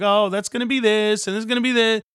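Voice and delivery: hint of a chirpy homebuyer; mock house buyer. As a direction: playfully imitate someone planning rooms. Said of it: "you're like".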